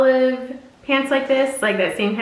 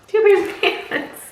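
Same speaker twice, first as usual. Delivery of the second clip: higher with a laugh on pants; 8 semitones higher than usual. Shown as a demonstration. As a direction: laugh it out amused. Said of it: "And we have two pairs of pants".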